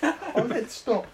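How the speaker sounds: high, slow, laughing stop; slow laughing plea. Real, not acted. Player begging the host to stop, laughing. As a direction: drag it out, laughing. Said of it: "repeated stop".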